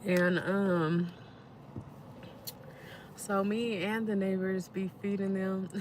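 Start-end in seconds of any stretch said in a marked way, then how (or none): none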